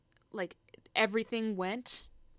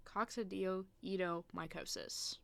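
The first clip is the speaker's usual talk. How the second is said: usual speaking voice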